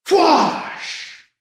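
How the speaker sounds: high falling whoosh; blaster whoosh. Acted. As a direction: blast sound cutting in. Another blast.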